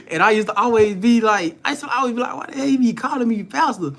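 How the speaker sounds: high laughing disbelief. Real, not acted. Young man laughing about the old man calling him pastor. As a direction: laugh through it, pitch up.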